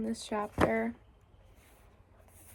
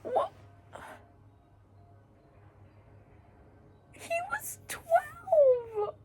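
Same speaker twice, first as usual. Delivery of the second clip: high, loud crying outburst; crying shock; 6 dB louder, much slower than usual. Real, not acted. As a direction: wail it through real tears. Shocked crying at book death.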